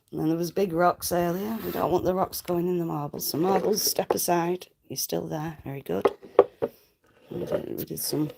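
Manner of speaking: ordinary delivery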